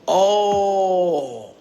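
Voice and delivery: long drawn-out "oh" of awe; awed reaction. Retold or imitated. Spellbound listeners reacting to a vivid story, long awed oh. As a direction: let it hang, like someone seeing a vision. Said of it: "they be like".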